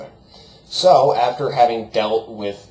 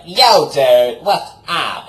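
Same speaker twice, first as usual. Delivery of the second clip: a hint of a loud dumb dude; 4 semitones higher, 9 dB louder, much slower than usual. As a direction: greet like a dopey bro, loud. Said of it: "why do you talk like that".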